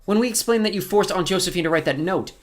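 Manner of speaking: put-on high, forceful girl's voice; defiant girl. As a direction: accuse the villain, pitched high. Girl defies villain in story.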